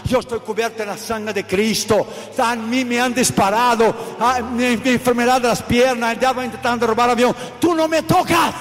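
loud defiant sermon shout; preaching at full force. Acted. Preacher defying a satanist's threat before the congregation, shouting. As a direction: hurl it back at the devil.

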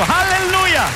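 high jubilant aleluya cry; shout of praise. Real, not acted. Exclamación de alabanza. (aleluya gritado, agudo y alegre)

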